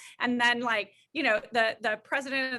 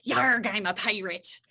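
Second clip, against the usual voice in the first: slow, drawn-out pirate voice, lightly done; pirate growl; 2 semitones lower, slower than usual. Retold or imitated. Mom coaching her son's line, mock pirate voice. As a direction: growl yarg like a cartoon pirate.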